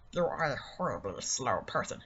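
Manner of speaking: a low heckling voice inside the head. Acted. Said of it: "Shut up, voice in my head".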